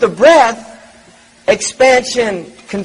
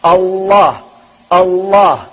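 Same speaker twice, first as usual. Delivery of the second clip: lower, even, measured "Allah" repeated in a steady rhythm; tongue demo; 6 semitones lower, slower than usual. Shown as a demonstration. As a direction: let each "Allah" fall in a slow steady pulse. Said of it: "That's what the tongue is doing".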